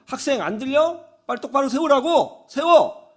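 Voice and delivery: high-pitched acted impatient order; retold scolding. Other passengers to the deaf-eared student, impatient scolding. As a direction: scold like an annoyed elder.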